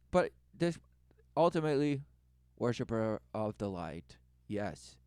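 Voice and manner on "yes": usual speaking voice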